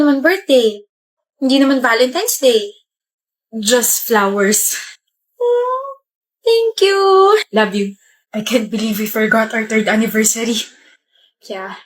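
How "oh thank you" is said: high, squeaky oh thank you; pleased little gasp; delighted surprise. Acted. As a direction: caught off guard by flowers. Surprised by flowers.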